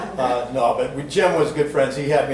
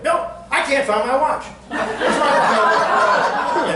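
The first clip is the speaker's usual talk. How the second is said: higher, laughing, puzzled; confused Glenn; 11 semitones higher, 4 dB louder, slower than usual. Retold or imitated. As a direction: play a forgetful man searching, amused. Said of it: "and Glenn goes".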